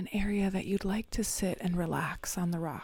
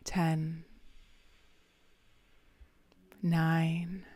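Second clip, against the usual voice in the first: calm numbers with long pauses; slow soothing countdown; much slower than usual. Acted. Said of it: "as I count backwards from ten to one".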